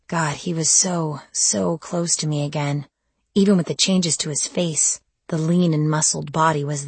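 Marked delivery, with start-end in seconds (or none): none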